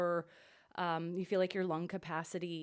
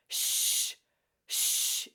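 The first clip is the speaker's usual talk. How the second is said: sharp, louder shh pulses; shushing; 7 dB louder than usual. Shown as a demonstration. Vocal coach demonstrating shushes from the solar plexus. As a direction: kick each shh out from the belly. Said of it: "We're gonna go".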